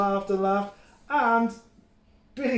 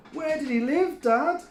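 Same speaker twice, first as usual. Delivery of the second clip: put-on high, louder child voice; eager little one wanting more; kid goat asking; 11 semitones higher, 6 dB louder than usual. A narrator's reading. Mike presses dad for the story, high and keen. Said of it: "asked Middle-sized Mike".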